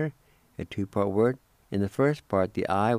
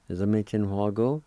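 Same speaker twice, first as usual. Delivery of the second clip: usual speaking voice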